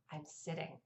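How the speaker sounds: said low and quiet; worn-out declaration. Retold or imitated. Exhausted mom spotting chairs in the hallway, low and quiet. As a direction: drop it right down, quietly done.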